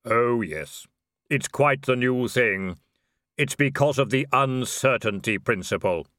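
drawn-out reaper musing; grim reaper voice. Acted. Death speaking.

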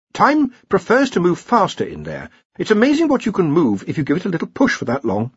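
high-voiced academic correcting his seniors; Ponder, earnest junior wizard. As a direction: explain patiently in a high voice. Ponder explaining project time.

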